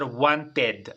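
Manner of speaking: "wanted" with a full E in the ending; wrong spelling pronunciation. Shown as a demonstration. Teacher showing students what to avoid, mispronounced. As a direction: say "wanted" as written, ending in TED. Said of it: "así con E".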